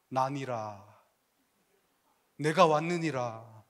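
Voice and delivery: a hint of a majestic messiah; lightly voiced grand arrival; mock grand entrance.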